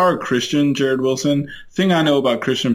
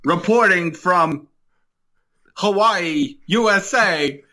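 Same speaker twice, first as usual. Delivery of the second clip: slow, high-pitched vintage radio reporter; old radio voice; 7 semitones higher, slower than usual. Acted. Co-host reporting a bombing from Hawaii, old radio voice. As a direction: announce it like an old-time radio bulletin. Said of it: "old, like, radio voice".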